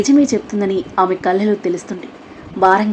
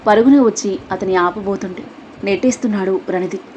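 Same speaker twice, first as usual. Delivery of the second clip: usual speaking voice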